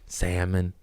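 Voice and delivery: normal talking voice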